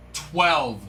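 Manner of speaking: usual speaking voice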